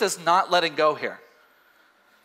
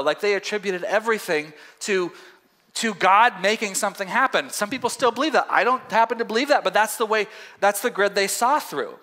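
ordinary talk, nothing marked

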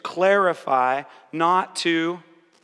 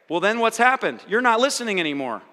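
usual speaking voice

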